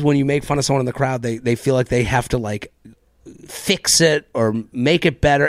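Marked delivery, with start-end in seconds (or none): none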